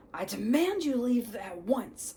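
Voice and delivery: loud, high indignant order; angry demand. Acted. Uncle ordering the giant out, loud and indignant. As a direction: bluster like an outraged householder.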